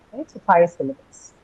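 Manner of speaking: ordinary talk, nothing marked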